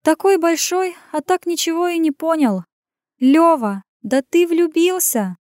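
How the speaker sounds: high teasing woman's voice; gleeful revelation. A narrator's reading. Woman telling a big man he is in love, gleeful. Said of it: "ошарашила".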